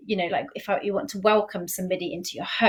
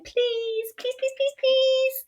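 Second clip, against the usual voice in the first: very high, soft begging "please"; pleading; 4 dB quieter, slower than usual. Shown as a demonstration. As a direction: beg over and over, high and soft. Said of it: "So look at my face".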